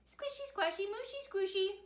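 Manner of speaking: high little piggy squeal; piggy's high reply. A narrator's reading. Piggy answers her family in a high squeaky voice. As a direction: chirp it high. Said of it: "Said the piggy".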